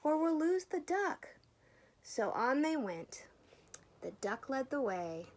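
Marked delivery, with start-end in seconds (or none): 0.0-1.3 high, loud call from the treetop. A narrator's reading. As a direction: call urgently to the others. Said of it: "called the bird".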